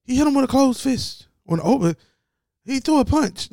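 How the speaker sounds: high, loud mock-shocked announcer; exaggerated announcer. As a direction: ham up the outraged TV commentator. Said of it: "how exaggerated".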